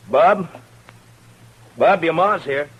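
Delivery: loud, high call through the house. Acted. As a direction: call out for the missing boy. Calling out for the boy.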